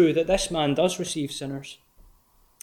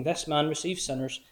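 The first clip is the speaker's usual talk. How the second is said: scornful quote; 7 dB quieter than usual. Retold or imitated. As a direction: quietly sneer out the scribes' complaint. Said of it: "They are scornful words".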